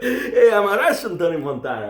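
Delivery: high, laugh-filled swagger; laughing boast. Retold or imitated. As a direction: brag through your laughter. Laughs through his boast.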